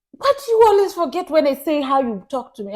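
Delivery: high-pitched imitation of nagging; mock complaint. Mimicking partner complaining about how she talks, accusing.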